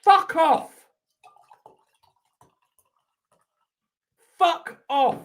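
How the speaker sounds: high-pitched, exasperated swearing; real anger. Real, not acted. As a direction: spit it out in high-voiced fury. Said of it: "I'm so angry right now".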